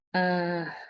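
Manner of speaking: ordinary talk, nothing marked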